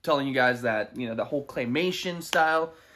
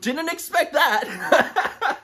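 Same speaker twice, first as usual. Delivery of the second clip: surprised; 13 semitones higher, 4 dB louder, much slower than usual. Real, not acted. Stunned by a surprise nomination.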